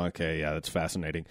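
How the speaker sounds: low, quiet, unimpressed reply; deadpan polite interest; feigned interest. Acted. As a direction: pretend it's fascinating, voice dropped low. Feigned interest in a dull answer.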